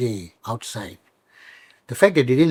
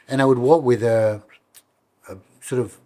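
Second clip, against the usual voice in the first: normal talking voice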